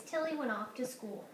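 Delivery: ordinary delivery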